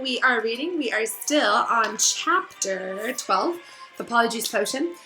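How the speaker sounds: ordinary delivery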